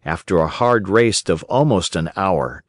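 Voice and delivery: ordinary delivery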